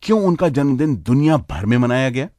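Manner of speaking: ordinary delivery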